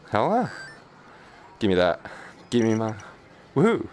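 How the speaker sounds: ordinary delivery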